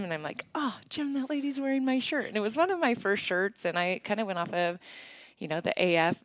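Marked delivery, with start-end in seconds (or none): 0.5-2.3 high-pitched reenacted gasp of news; giddy and high, reliving the moment; excited retelling. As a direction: act out telling a friend the thrilling news. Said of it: "So I was pretty excited".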